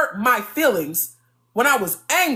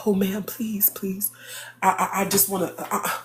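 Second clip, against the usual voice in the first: whispered mock pleading; imitating Kev pleading; 4 semitones lower, 6 dB quieter than usual. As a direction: beg in a hushed breathy whisper.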